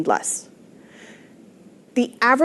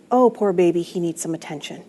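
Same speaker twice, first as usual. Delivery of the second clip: mock pity; 3 semitones lower, 6 dB quieter than usual. Shown as a demonstration. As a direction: coo over him like a fussy infant, tongue in cheek. Coach demos a new thought.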